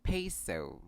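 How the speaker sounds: "peso" as PAY-soh; correct pronunciation. Shown as a demonstration. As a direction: glide both vowels in "peso": PAY-soh. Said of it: "emphasize the diphthongs".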